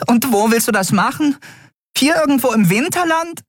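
very high, exasperated little voice; exasperated fairy. Acted. Nelen challenging Keru, high and exasperated. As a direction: scoff at the plan.

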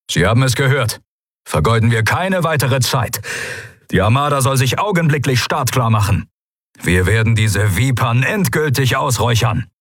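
rally the officers with firm orders; commanding address. A narrator's reading. Commander turns to his people, ordering them decisively.

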